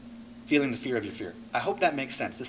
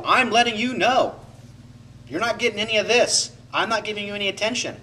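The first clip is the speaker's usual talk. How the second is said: higher and louder, taunting; mock withholding spite; 6 semitones higher, 4 dB louder than usual. Shown as a demonstration. Acting out withholding from a partner, taunting spite. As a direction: spite them with every word. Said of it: "I'm expressing my anger".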